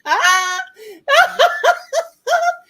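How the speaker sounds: high squealing burst of laughter; shrieking laugh. Real, not acted. Hearing a dirty double meaning, shrieking laughter. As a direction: cackle helplessly, way up high.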